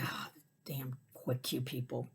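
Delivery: whispered grumble, slow and soft; under-breath whisper. Retold or imitated. Annoyed in line, whispering to self. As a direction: hiss it to yourself in annoyance.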